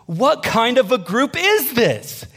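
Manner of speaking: high, drawn-out incredulous question; astonished neighbor. Retold or imitated. Neighbor approaching the young adults, astonished.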